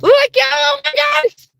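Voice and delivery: very high, loud excited squeal; super excited. Real, not acted. Investigator shows viewers the sensor, super excited. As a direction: burst out high and loud, thrilled. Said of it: "I get super excited".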